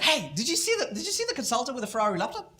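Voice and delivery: high, fast gossip; excited gossip. Retold or imitated. Staff gossiping in the tea room, high and fast. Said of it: "they're like".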